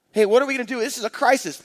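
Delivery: a touch of a child's mock panic; worried kid. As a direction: sound the family alarm. Kid voiced in crisis.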